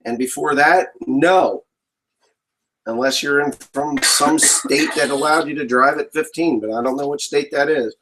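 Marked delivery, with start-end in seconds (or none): none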